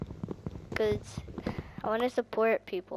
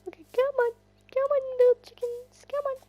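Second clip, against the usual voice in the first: very high, louder coaxing; coaxing call; 6 dB louder, faster than usual. Real, not acted. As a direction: lure the little chickens along sweetly. Coaxing chickens to follow.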